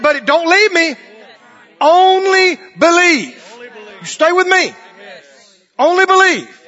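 high, loud preaching; preaching at full force. Acted. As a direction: drive the story home at full voice.

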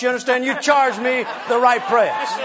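normal talking voice

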